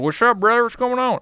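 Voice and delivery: high loud hillbilly greeting, Southern accent. Shown as a demonstration. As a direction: throw out the Southern hillbilly hello. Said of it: "doing accents".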